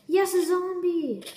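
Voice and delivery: high, slow thrilled cry; excited shout. Real, not acted. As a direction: yell it out with glee. Spotting a zombie in game.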